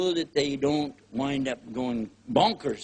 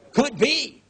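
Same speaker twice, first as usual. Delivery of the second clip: mock suspicious; 9 semitones higher, 6 dB louder than usual.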